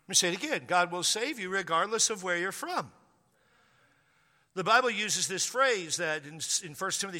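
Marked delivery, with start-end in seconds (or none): none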